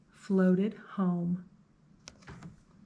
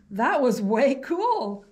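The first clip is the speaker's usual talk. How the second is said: higher, louder, slow and impressed; delighted principal; 3 semitones higher, 6 dB louder than usual. Acted. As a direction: relish the praise. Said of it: "the principal said".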